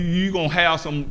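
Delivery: usual speaking voice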